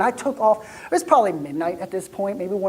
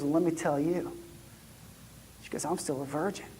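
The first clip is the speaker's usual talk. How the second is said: hushed; 5 semitones lower, 14 dB quieter than usual. Retold or imitated. Mary's confiding in a hush.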